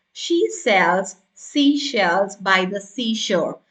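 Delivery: slow, carefully articulated "seashells" and "seashore"; each s and sh in "seashells" distinct, unhurried; crisp tongue twister. Shown as a demonstration. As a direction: articulate "She sells seashells" slowly and crisply. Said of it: "make your delivery very crisp".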